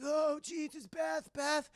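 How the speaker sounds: high, shouting alarm at her name; panicked calling. Retold or imitated. Rob finding his wounded friend, high panicked shout. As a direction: cry out her name in shock. Rob finds Beth impaled.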